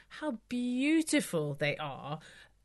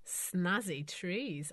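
slow and savouring; much slower than usual. Real, not acted. Repeats the quip slowly.

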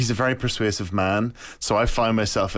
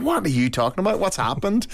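high, baffled questioning; bewildered wife; 9 semitones higher than usual. Retold or imitated. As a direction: sound lost and alarmed at once. Wife questioning him in bed.

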